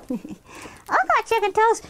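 high-pitched little chicken character; puppet chick voice. Acted. Chicken Little chiming in to the storyteller, high puppet voice. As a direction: pipe up eagerly in a small high puppet voice.

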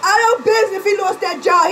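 high, loud laughing yell; high scornful laughing shout; laughing outburst. Real, not acted. Laughing rant about ex.

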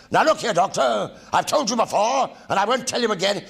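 deadpan stern rebuke, straight-faced; stern brigadier telling off the Doctor, mock serious; Nick Courtney's line. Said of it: "he looked at me, and he said".

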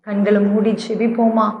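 ordinary delivery

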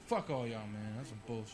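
low, soft grumbled comeback; retold sulky retort. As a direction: grumble it under the laughter, half-hearted. Retells his sulky reply to laughers.

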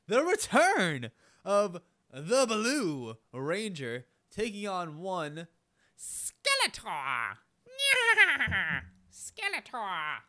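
slow, drawn-out ring announcer call; booming, drawn-out fighter introduction. Acted. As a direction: stretch every name like a ring intro.

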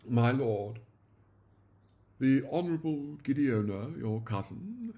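stately butler announcing a guest; formal announcement. A narrator's reading. Butler announcing the cousin to his lord, formally. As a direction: announce the visitor grandly. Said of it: "announced Leighton".

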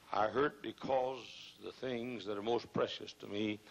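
ordinary delivery

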